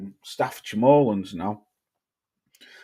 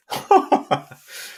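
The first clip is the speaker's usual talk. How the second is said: a high, breathy burst of laughter; sudden laugh; 3 dB louder than usual. Real, not acted. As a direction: laugh out loud at the comment. Laughs while reading chat.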